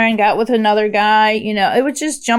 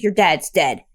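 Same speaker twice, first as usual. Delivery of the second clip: cold-hearted; 6 semitones lower, 3 dB quieter than usual. Retold or imitated. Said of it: "like, cold-hearted".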